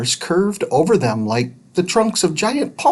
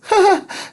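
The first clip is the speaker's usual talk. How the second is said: put-on short bright girlish laugh; light laugh. A narrator's reading. Judy amused by dust piles, light laugh. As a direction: laugh at the idea. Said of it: "Judy laughed".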